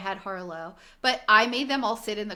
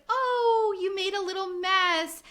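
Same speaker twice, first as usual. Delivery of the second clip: sweet mom voice; 8 semitones higher, slower than usual. Retold or imitated. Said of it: "they're like".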